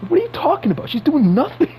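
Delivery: exasperated, voice leaping up; incredulous outburst. Real, not acted. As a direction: pitch jumps high in disbelief. Exasperated rant about MJ.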